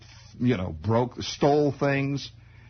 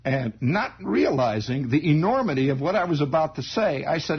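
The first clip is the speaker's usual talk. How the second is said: normal talking voice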